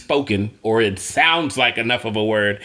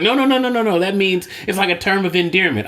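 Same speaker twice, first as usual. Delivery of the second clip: hurried reassurance; 6 semitones higher than usual. Retold or imitated. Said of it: "And she was like".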